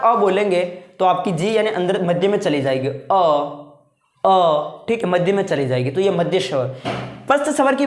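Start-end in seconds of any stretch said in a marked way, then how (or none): 2.9-4.8 teacher sounding out a short central अ; vowel demo. Shown as a demonstration. Teacher shows students the middle vowel, plain short अ. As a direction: say अ with the tongue resting mid-mouth. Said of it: "आप बोल के देखो अ".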